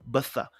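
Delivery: Betha said "BEE-tha", TH not Z; Ibiza's ending with TH. Shown as a demonstration. Host spells out the phonetics, Betha as BEE-tha. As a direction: finish Betha on a soft TH. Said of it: "spelled with the phonetics of E E".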